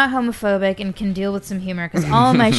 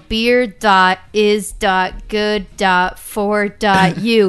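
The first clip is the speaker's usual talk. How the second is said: slow, louder, each dot deliberate; spelled slowly; 6 dB louder, much slower than usual. Shown as a demonstration. Guest dictating her Instagram handle slowly and clearly. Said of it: "spelling out her handle".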